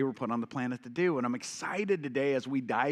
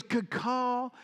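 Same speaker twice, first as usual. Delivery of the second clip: put-on Southern accent; 8 semitones higher than usual. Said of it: "Southern accent".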